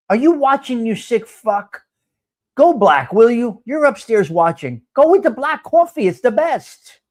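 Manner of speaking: calling upstairs. Real, not acted. Yelling up at upstairs neighbor.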